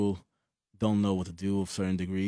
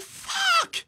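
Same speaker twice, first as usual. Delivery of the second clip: shocked cry. Retold or imitated. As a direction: blurt it out high as the dent hits. Shock at the wreck.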